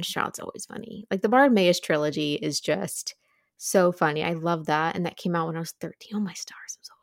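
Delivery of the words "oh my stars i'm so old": breathy mock-dismayed whisper. Real, not acted. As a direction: whisper it to yourself in mock horror. Dismay at feeling old.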